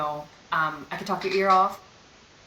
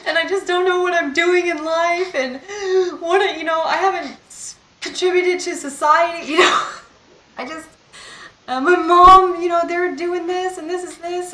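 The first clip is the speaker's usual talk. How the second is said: high, loud, breaking into sobs and laughs; crying rant; 10 semitones higher, 7 dB louder than usual. Retold or imitated. Reliving the meltdown for her viewers, sobbing wail. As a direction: let the voice crack as you wail it out.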